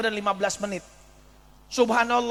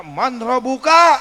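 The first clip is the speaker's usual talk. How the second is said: slow, loud interrogation; grave angel asking the dead; angel's stern question; 4 semitones higher, 10 dB louder, slower than usual. Acted. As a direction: demand the answer slowly and gravely.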